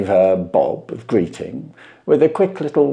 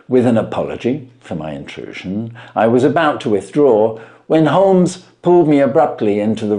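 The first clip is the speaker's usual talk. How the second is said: measured storyteller reading; story narration; 3 semitones higher than usual. A narrator's reading. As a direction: tell it like a classic mystery read aloud.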